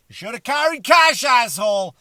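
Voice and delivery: very high put-on jeering voice; high-pitched mock scolding; mocking heckler. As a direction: jeer it like a squeaky know-it-all heckler. Voicing the audience's heckle at him.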